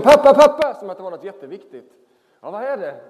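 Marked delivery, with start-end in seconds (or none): 0.0-0.7 put-on high loud child's call from upstairs. Daughter calling to dad from upstairs, high eager shout. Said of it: "ropade hon från övervåningen". 2.4-2.9 calling back. Retold or imitated. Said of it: "Så ropar jag nerifrån".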